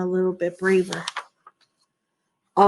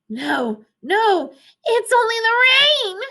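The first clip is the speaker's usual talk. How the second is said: high squawky duck; 11 semitones higher than usual. Acted. Duck character informs.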